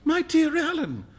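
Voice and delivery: very high exclamation of protest; startled, high-pitched outcry; crying out. A narrator's reading. Young man to painter friend, exclaiming in dismay. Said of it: "cried Huey".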